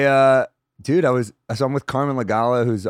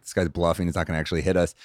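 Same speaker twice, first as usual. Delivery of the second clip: low quiet aside; 6 semitones lower, 6 dB quieter than usual. Retold or imitated. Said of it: "I kinda turn around like".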